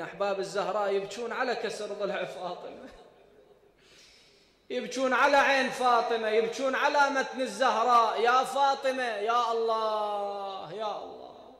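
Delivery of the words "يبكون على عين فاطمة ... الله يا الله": louder wailing lament, drawn out at the end; mournful lament. Acted. Preacher laments Fatima before the mourners, wailing. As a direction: cry out the grief of Fatima.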